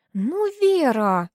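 whiny drawn-out plea; pouty, dragging out the name; whiny spoiled pouty drawl. A narrator's reading. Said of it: "закапризничала Ирка".

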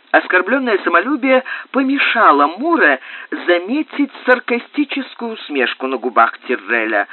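plain reading voice

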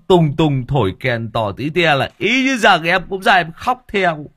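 high, loud tipsy voice; drunken protest. A narrator's reading. Drunk Long defending singing.

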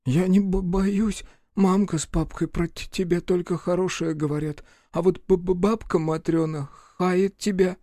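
put-on high child voice stuttering from cold; stammering boy. A narrator's reading. Freezing boy Гришка answers Рух in a high stammer. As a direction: shiver out the words like a freezing child.

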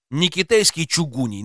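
usual speaking voice

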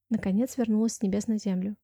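usual speaking voice

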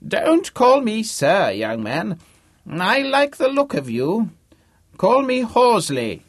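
put-on high, loud, slow old-man voice; crotchety old man. A narrator's reading. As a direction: ham up a doddery ancient benefactor. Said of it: "his voice loud, old, and very convincing".

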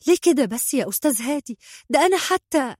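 high-pitched, quick appeasing plea; placating. A narrator's reading. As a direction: try to soothe someone angry. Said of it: "في محاولة لتهدئة الأجواء".

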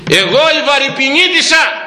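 high, proud exclamation from a woman; proud woman quoted. Retold or imitated. Priest quoting a woman boasting of her village, high and proud.

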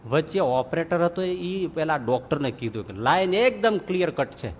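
ordinary talk, nothing marked

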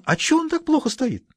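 high mocking schoolgirl voice; girl's voice. A narrator's reading. Pioneer girl mocking the governor, high and taunting. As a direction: taunt him in a high girlish voice.

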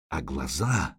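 low, hushed, creeping dread; low looming threat. A narrator's reading. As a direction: let it sink low and soft. Horror at the eyes.

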